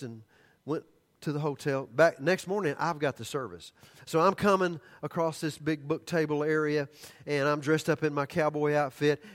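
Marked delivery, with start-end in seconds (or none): none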